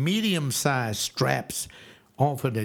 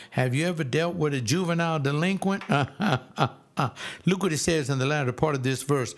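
ordinary delivery

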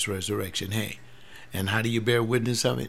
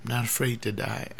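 normal talking voice

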